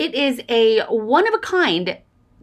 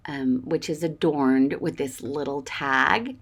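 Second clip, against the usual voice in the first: normal talking voice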